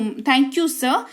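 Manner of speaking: very slow, spelled-out English for practice; slow model sentence. Shown as a demonstration. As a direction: lay out every word slowly. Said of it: "teacher models the English line". (धीमी गति में अभ्यास वाक्य)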